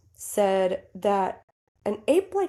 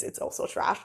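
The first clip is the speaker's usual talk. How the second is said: whispered; 6 dB quieter than usual. Real, not acted. Repeating co-host quietly, unvoiced.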